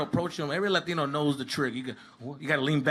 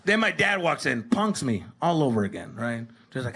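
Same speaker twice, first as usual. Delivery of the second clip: normal talking voice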